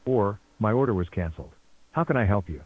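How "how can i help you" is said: usual speaking voice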